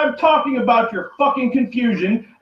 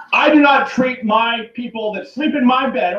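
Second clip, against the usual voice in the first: ordinary talk, nothing marked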